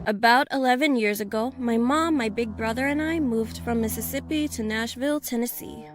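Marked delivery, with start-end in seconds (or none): none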